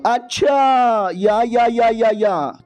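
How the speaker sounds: stretched-out mock realisation; fake recognition. Hero pretending he remembers the witch, hammed-up drawl.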